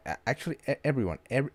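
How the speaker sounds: ordinary talk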